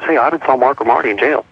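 plain reading voice

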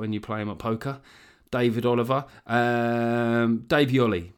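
normal talking voice

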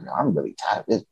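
tired, dropped-voice reenactment; weary refusal. Retold or imitated. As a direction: privately admit being fed up. Himself, tired of dating, acted.

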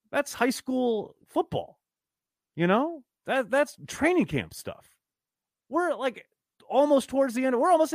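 high, exasperated disbelief; incredulous disgust. Real, not acted. Incredulous rant at sloppy team.

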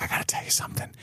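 soft whisper, no voice. Retold or imitated. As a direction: whisper it like a secret confession. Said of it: "she's like".

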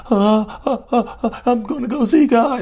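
high, fake-sobbing whimper of a manipulator; hammed-up blubbering threat, stammering; mock fake sob. Mocking a narcissist fake-crying to a partner, high whiny sob.

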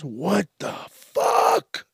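slow, high, crying-out what the fuck; slow high anguished what the fuck; drawn-out disbelief. Real, not acted. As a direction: wail in stunned disbelief. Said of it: "reacting to exploding farm".

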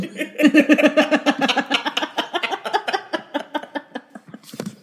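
long high peal of laughter; real laughter. Real, not acted. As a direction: let a big laugh roll out. Big laugh at the joke.

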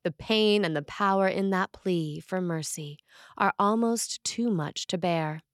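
ordinary talk

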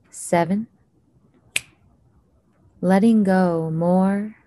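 unhurried calming count with long pauses; slow soothing countdown. Acted. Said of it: "I'm going to count down from ten to one".

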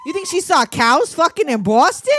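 loud, high, incredulous Bostonian; hammed-up Boston disbelief; mock Boston accent. Co-host doubting cows in Boston, in a mock Boston accent. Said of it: "Just got possessed by a Bostonian".

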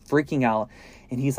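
plain reading voice

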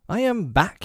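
high, heated and annoyed; hotly. A narrator's reading. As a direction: say it with hot impatience. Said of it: "he said hotly".

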